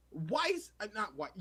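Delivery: normal talking voice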